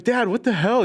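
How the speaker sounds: put-on high-pitched, exasperated kid protest; mock outrage at dad, voice jumping up; acted-out indignant son. Timmy protests after dad beats him.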